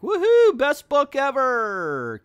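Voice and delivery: very high, loud whooping cheer; hammed-up rave, drawn out slow at the end; mock elation. Reviewer imitating a delighted fan, mock ecstatic. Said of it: "Are you like".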